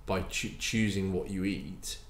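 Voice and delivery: normal talking voice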